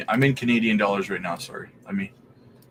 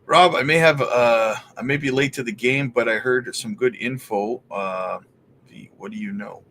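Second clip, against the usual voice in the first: normal talking voice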